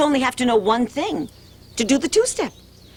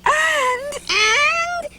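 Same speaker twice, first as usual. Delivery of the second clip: excited. Acted. Excited build-up in story.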